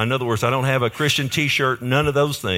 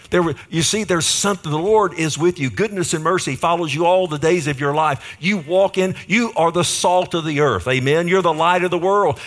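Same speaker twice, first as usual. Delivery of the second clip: higher, louder preacher cadence; full-force preaching; 5 semitones higher, 4 dB louder than usual. Acted. Preacher exhorting the congregation that they are the light, full-force preaching. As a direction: build it up like a revival.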